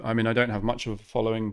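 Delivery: normal talking voice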